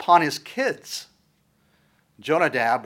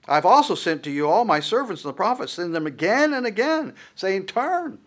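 louder, higher impassioned preaching; preaching at full force; 6 semitones higher, 4 dB louder than usual. Acted. Preacher reading God's plea to Judah, impassioned. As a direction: build to the cry of turn.

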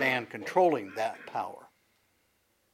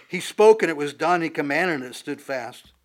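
proclaiming; 3 semitones higher, 11 dB louder than usual. Acted. Reading scripture with force.

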